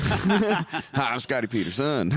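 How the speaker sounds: high, jokey greeting; a hammed-up stranger; mock introduction. Introduces a made-up persona.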